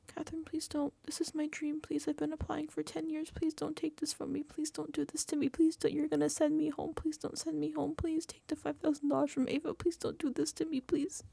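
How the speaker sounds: high, soft run of nonstop begging; mock pleading. Grown man in detective costume begging Catherine, mock pleading. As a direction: grovel on and on in a high little pity voice. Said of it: "grown man in a detective costume".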